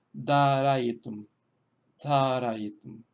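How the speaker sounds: "Darayitum" with a long, accented DA; careful demonstration of "Darayitum", DA stressed; stress on first syllable. Shown as a demonstration. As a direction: model "Darayitum", drawing out the opening DA. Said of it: "llevar el acento a la primera sílaba".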